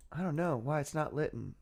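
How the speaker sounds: ordinary delivery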